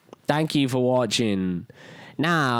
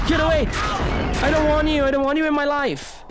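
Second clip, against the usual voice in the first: panicked yelling; 11 semitones higher than usual. Real, not acted. Gamer fending off a rushing enemy, panicked yelling.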